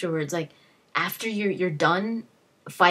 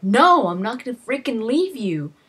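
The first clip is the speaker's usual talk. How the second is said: louder, higher retold protest; raised-voice mock outburst; acted adamant refusal; 5 semitones higher, 6 dB louder than usual. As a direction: play out refusing to abandon a friend. Retold refusal to abandon companion.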